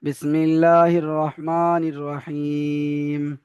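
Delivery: slow, measured chanted recitation; Quranic recitation. Acted. Teacher opening the class with slow recitation. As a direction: intone the opening slowly and reverently.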